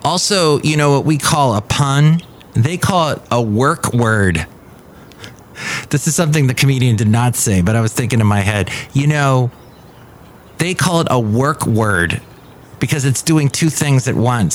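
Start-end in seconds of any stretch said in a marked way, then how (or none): none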